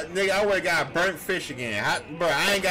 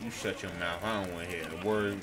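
mock stern scolding; 10 semitones lower, 8 dB quieter than usual. Mock stern scolding over fish.

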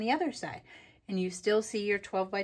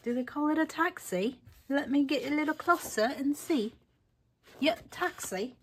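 higher-pitched put-on British accent; mock British accent; 5 semitones higher than usual. Crafter peering at little taxis, in mock British accent. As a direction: play at being British, lightly hammed-up. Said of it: "That was [name]'s accent".

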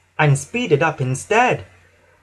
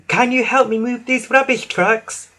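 high, tooting plea; Emily; 9 semitones higher than usual. A narrator's reading. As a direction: ask for help in a high voice. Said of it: "tooted Emily".

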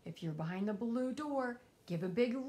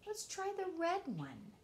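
plain reading voice

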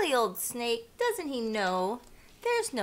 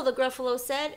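ordinary delivery

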